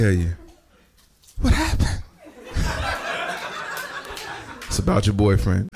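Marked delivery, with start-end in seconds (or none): 1.4-2.0 put-on high, squeaky girlfriend voice; girlfriend role. Comedian plays the girlfriend getting bad news, high and squeaky. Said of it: "do both roles". 2.5-4.6 shocked gasp, whispered; breathy gasp. Acted. Girlfriend hears about her boyfriend, breathy gasp. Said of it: "do both roles".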